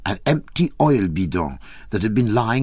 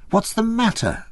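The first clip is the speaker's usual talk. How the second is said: put-on higher, worried peasant girl; peasant girl asking; 12 semitones higher than usual. A narrator's reading. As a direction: press him anxiously. Maria asks again.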